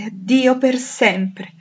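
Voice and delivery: high, loud, drawn-out farewell; anguished outcry, stretched and falling; despairing cry. A narrator's reading. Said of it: "e la disperazione aggiunse".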